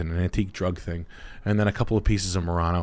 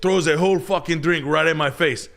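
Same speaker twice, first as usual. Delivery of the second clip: imitating buddy; 11 semitones higher than usual. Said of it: "He's like".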